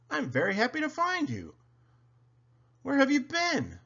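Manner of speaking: high, delighted voice; Stanley, whistling happily. A narrator's reading. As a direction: speak high, delighted at finding a friend. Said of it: "whistled Stanley".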